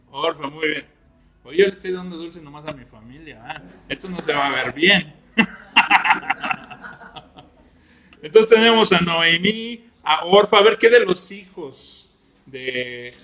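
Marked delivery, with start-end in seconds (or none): 5.4-7.4 loud burst of laughter; hearty laugh. Real, not acted. Teacher joking about favouring his family with sweets, laughing. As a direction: laugh out loud at your own joke.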